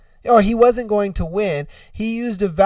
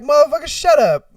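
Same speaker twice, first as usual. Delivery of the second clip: higher, quick playful snap; mock irritated retort; 6 semitones higher, 4 dB louder, faster than usual. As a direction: shut them down, half-joking. What he would have yelled back.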